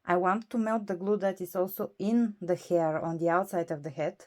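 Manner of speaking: usual speaking voice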